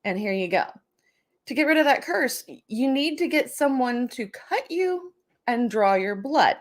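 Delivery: ordinary talk, nothing marked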